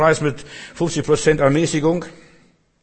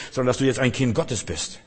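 normal talking voice

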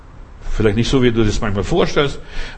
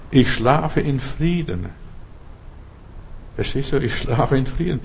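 soft calm; 7 dB quieter than usual. Acted. Quoting calm trust, softly.

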